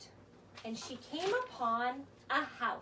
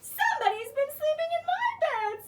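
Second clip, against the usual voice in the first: much higher, fast, louder cub voice; Baby Bear; 12 semitones higher, 6 dB louder, faster than usual. Acted. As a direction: squeak it out like a small cub. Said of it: "said Baby Bear".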